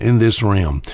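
ordinary talk, nothing marked

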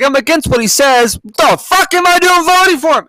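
high, loud disbelieving outburst; mock exasperation. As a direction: rant at yourself in disbelief. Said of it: "I was like".

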